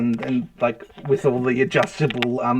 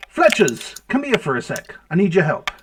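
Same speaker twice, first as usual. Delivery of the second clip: higher, louder call to the back room; 5 semitones higher, 4 dB louder than usual. Acted. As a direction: summon a helper from the next room. Dwarf calls helper from back room.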